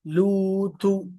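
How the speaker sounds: लूतु slowly, the pesh said with fully rounded lips. Shown as a demonstration. Teacher demonstrating full lip rounding. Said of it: "पूरा पेश होंठ गोल".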